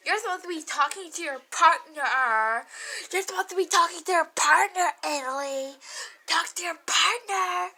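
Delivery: loud, mocking imitation of his bossy yelling. Ex-boyfriend yelling across the music room, loud mocking imitation. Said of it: "he was yelling across the room at me".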